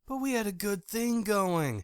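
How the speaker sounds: wheedling ex. A narrator's reading. Drake pleading falsely.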